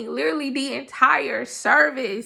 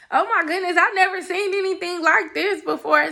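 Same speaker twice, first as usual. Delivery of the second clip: excited; 6 semitones higher than usual. Retold or imitated. Amazed at church welcome.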